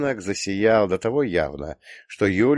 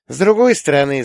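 put-on high, loud musing girl; drawling daughter; 8 semitones higher, 8 dB louder than usual. A narrator's reading. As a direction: draw out the phrase, pitched high. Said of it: "затянул доча". (протяжно, высоким голосом дочки)

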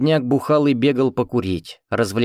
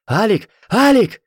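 high, rising to a louder call; calling shout; 11 semitones higher, 6 dB louder than usual. A narrator's reading. Frightened man calling for Alik, loud high shout. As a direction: call out for a missing boy. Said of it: "испуганный мужчина и призывно кричал".

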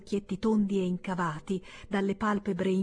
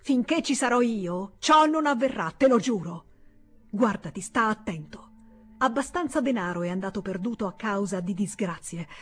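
furious tirade; 3 semitones higher, 4 dB louder than usual. Acted. Senator's furious tirade at brother.

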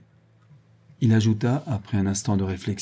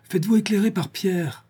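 higher called reminder; calling after; 10 semitones higher than usual. A narrator's reading. Monsieur de Gueldre calling after departing Liane, raised call. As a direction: call it after her, pitched up. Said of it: "criait monsieur de Gueldre".

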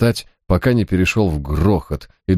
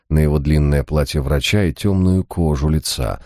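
usual speaking voice

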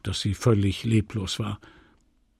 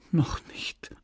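breathy half-voiced whisper, much softer; whispered reply; 7 dB quieter than usual. Emma whispering back to Leon, a grim whisper. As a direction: let the words barely escape.

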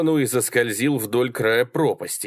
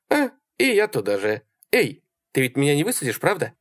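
higher-pitched woman with veiled threat; Sally, sweetly menacing; 5 semitones higher than usual. A narrator's reading. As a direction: warn the driver lightly. Sally hints threat to new driver.

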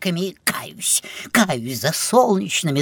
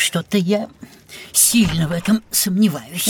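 doubtful whisper. Acted. Grumpy birthday guest doubts the fun.

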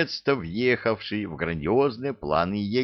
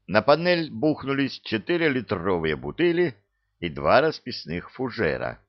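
ordinary delivery, nothing marked

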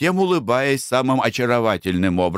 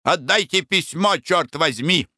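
high angry demand; 7 semitones higher than usual. A narrator's reading. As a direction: swear and demand it. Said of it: "закричал баронет".

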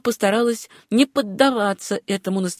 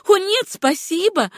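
high, light exclamation of refusal; laughing exclamation; 12 semitones higher than usual. A narrator's reading. Susanna refuses another pastry from the baker, amused high exclamation. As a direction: exclaim it in a much higher voice, amused. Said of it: "смеясь воскликнула Сюзанна".

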